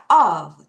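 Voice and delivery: "Of" said low and firm as a model; pronunciation demo. Shown as a demonstration. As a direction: model "Of" clearly for repeating. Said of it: "O F 발음 어떻게 해요". ("Of" 발음 시범)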